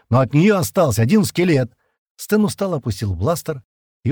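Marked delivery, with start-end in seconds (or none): 0.1-1.7 raised, harsh voice; sharp reply. A narrator's reading. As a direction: throw the answer out loudly and sharply. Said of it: "резко ответил Стэн". (резко, на повышенном тоне)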